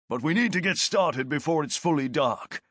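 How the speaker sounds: lightly voiced veteran sergeant; a hint of a steady soldier; sergeant's voice. Martius voiced by narrator.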